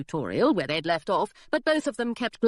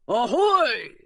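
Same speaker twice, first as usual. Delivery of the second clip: burly pirate greeting; 4 semitones higher, 5 dB louder than usual. A narrator's reading. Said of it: "said the burly pirate".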